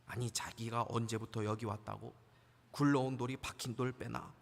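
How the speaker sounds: low, hushed grumble of resentment; jealous grumble. Acted. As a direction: grumble under your breath like an envious old-timer. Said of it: "시기하는 사람들이 있을 수도". (시기하듯 낮게 투덜거리는 말투)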